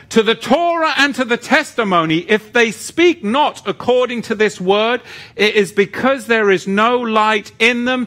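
high, driving scripture declamation; forceful preaching. Real, not acted. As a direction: preach it out with full conviction.

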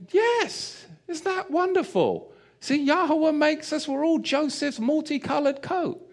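put-on sing-song teaching tone to a toddler; mock cheerful dad. Dad answering his son in the shop, a hint of cheerful teacher.

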